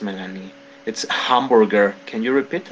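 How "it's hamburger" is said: hamburger with a clear breathy H, said slowly; teacher modelling hamburger with the H; model pronunciation. Shown as a demonstration. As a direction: sound out the H in hamburger. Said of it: "a little problem with the pronunciation of this".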